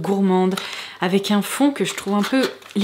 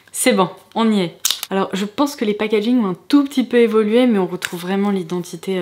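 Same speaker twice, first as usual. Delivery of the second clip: ordinary delivery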